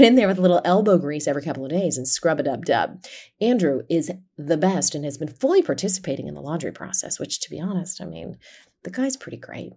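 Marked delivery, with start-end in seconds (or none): none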